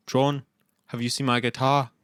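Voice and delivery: mock Liverpool accent, asking politely. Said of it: "I just started doing the Beatles".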